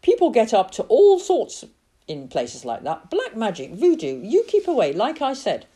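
a lightly voiced aunt, firm; warning Auntie May. A narrator's reading. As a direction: warn him off like a fussing aunt.

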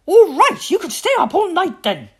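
high, loud exasperated outburst; angry roar. A narrator's reading. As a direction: roar it like a mother who has lost her temper. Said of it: "Mummy Tiger roared".

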